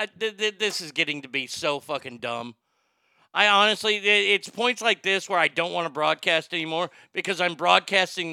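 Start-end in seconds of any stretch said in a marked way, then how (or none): none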